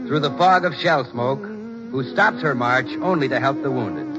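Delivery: ordinary talk, nothing marked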